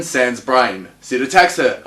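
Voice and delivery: ordinary delivery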